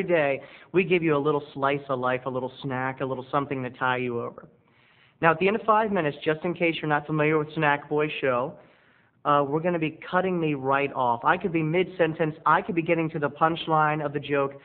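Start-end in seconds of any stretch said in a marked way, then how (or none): none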